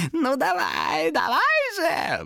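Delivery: very high, drawn-out squeal; squealing demon. A narrator's reading. Demon goads Томас to swing the axe, squealing. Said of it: "взвизгнул он".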